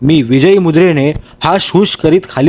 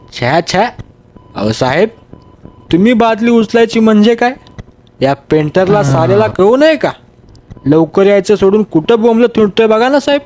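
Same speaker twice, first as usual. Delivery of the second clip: higher, scandalised servant voice; indignant workman; 4 semitones higher than usual. Acted. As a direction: tut at the boss, then grumble about the painter. Workman's voice in story.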